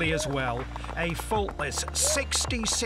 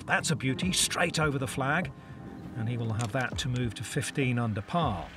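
hushed golf play-by-play; golf commentator; 5 semitones lower, 7 dB quieter than usual. Real, not acted. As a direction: call the shot softly.

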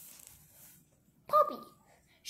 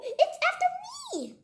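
panicked squirrel. Acted. Squirrel fleeing into the chicken coop, panicked. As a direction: pitch it way up, in a panic. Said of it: "out of breath and in a panic".

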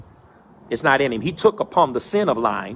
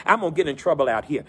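ordinary delivery

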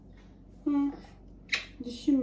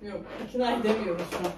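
ordinary talk, nothing marked

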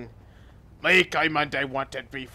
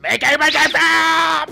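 much higher, louder cheering shout; excited caveman yell; 10 dB louder than usual. Acted.

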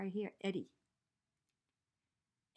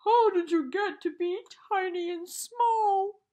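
much louder, higher wail; bawling; 9 semitones higher, 13 dB louder than usual. A narrator's reading. The giant bear cries out to its tiny teddy, bawling loudly. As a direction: bawl it across the woods. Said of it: "could hear the voice bawl".

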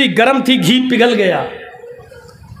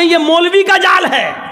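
punchline shout; 8 semitones higher, slower than usual. Retold or imitated.

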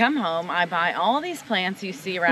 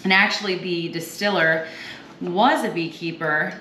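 louder, bright show-host read presenting the spirit; upbeat cocktail demo host; 4 dB louder than usual. Acted. Host to viewers, introducing Bar Hill cocktail, louder upbeat presenter read. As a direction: sell it like a cooking-show host.

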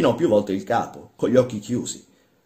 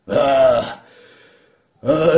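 higher, loud yawning "ah"; 5 semitones higher, 10 dB louder than usual. Acted. Old master yawning before the workman, loud yawn. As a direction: yawn aloud, drawn out. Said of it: "esclamò il Ciancarella con uno sbadiglio".

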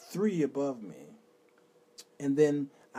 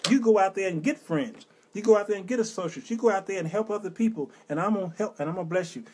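stern urging; 6 semitones higher, 7 dB louder than usual. Retold or imitated. Voice reminding him to lead.

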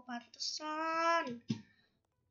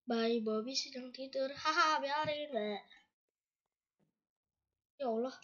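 ordinary delivery

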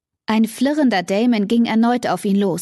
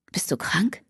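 softer whispered disbelief; incredulous whisper; 5 dB quieter than usual. Acted. Damon whispering in disbelief at the woman defending Blake. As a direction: breathe it out in disbelief.

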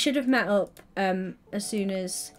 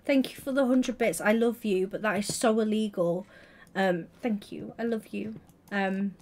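ordinary talk, nothing marked

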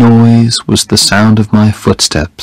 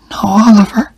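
softening dying woman; 12 semitones higher than usual. A narrator's reading. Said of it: "she said".

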